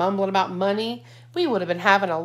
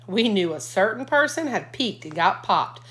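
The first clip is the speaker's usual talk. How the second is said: plain reading voice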